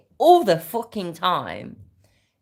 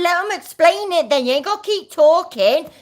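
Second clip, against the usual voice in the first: high, loud, mocking sarcasm at the screen; sarcastic outburst; 6 dB louder than usual. Real, not acted. Reacting to the video, she mocks him with loud, high sarcasm. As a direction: scoff at him in a shrill mocking pitch.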